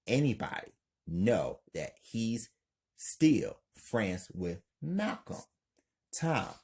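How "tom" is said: ordinary delivery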